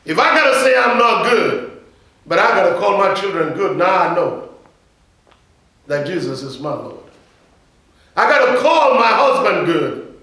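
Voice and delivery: loud, high preacher's cry; full-force sermon delivery; fiery preaching. Acted. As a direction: preach it out to the church.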